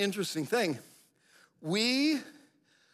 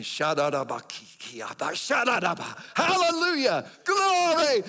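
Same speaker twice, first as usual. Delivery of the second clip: murmured tongues building to a much higher, louder praise; 8 semitones higher, 5 dB louder than usual. Retold or imitated. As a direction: ecstatic tongues swelling into a hallelujah. Speaking in tongues, rising praise.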